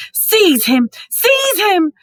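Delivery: shrieked. A narrator's reading. Voldemort shrieking at Quirrell again, loud and high. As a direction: shriek the order, climbing in pitch. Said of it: "shrieked Voldemort again".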